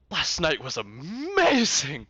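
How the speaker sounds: loud, drawn-out manic gushing; over-the-top wired enthusiasm; manic excitement. Acted. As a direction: rave it with big stretched emphasis. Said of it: "he's in this manic state".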